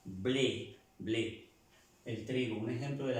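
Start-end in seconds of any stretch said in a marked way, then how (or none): 0.0-1.5 "blé" with a clear Spanish-like E, falling; French "blé" with a closed E; acute-accent E. Shown as a demonstration. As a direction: model "blé" twice, E fully sounded. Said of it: "Con este acento agudo se pronuncia".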